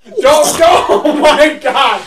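high, loud pleading through laughter; real helpless laughing. Real, not acted. Host begging friend to stop, laughing loudly. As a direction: beg loudly while cracking up. Cracking up again.

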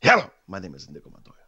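lightly voiced Inigo Montoya, formal; a hint of the Princess Bride swordsman; Inigo Montoya impression. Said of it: "Princess Bride, dude".

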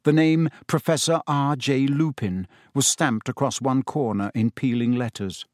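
plain reading voice

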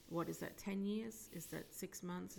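ordinary talk

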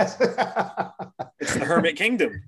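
chuckling through the name; amused, giggling delivery; laughing. Real, not acted. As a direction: laugh as you say it. Laughing while explaining.

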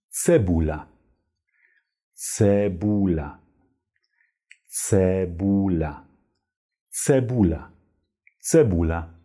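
"Cebula" with a ts start, repeated clearly; Polish model word. Shown as a demonstration. Teacher drilling Polish word for onion. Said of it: "Die Zwiebel heißt auf Polnisch".

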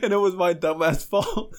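high voice breaking into laughter; squeaking up with amusement at himself; real amusement. Real, not acted. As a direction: laugh through the confession. Laughing again at his own mistake.

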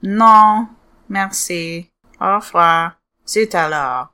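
hammed-up French phrases; French accent. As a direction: play a cartoon French speaker. Mock French conversation.

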